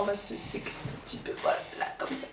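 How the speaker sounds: fast, whispered to herself; whispered aside. Real, not acted. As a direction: whisper it under your breath. Quiet aside while fixing herself.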